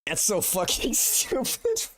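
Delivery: high, slow words squeezed out through crying laughter; can barely get it out for laughing; real helpless laughter, voice cracking high; laughing to tears. Real, not acted. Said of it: "I actually have tears".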